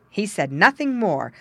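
ordinary delivery, nothing marked

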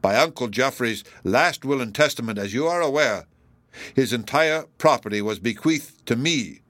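plain reading voice

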